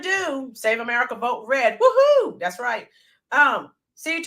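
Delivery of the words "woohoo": high, loud cheer; real excited whoop. Real, not acted. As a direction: rally cheer. Cheers after slogan.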